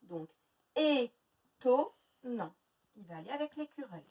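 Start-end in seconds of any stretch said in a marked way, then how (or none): 0.7-2.5 étonnant very slowly, each syllable apart. Shown as a demonstration. Teacher placing the E sound for pupils, very slow. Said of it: "Où est-ce que j'entends le E?".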